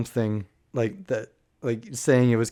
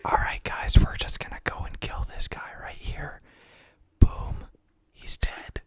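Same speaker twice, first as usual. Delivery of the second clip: hushed ASMR gamer whisper; 5 dB quieter than usual. Pretend. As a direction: whisper it close to the mic. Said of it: "where you just whisper all the time".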